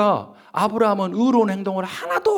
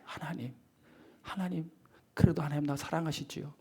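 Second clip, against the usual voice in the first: put-on hushed, childlike plea, much softer; soft pleading prayer; 9 dB quieter than usual. Believer running back to God, hushed pleading. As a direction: whisper it like a child begging for love. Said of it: "하고 하나님 품으로 뛰어드는".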